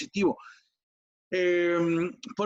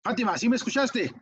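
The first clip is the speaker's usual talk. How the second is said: mock-cross mum, pitched up; imitating an irritated mother's higher-pitched call; annoyed mother; 5 semitones higher than usual. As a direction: check sharply that the child is listening. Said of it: "se encabrita y te dice".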